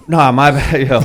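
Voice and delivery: loud, high, laughing; laughing apology. Real, not acted. Apologizing to friends while laughing loudly.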